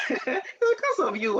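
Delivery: repeated through a high laugh; laughing blame. Acted. Playfully blaming the customer, laughing. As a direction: laugh it out while blaming them.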